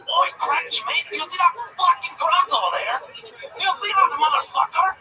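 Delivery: loud, high-pitched mock Aussie accent; Australian impression. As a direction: holler it like a caricature Australian. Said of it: "let's hear your Australian impression".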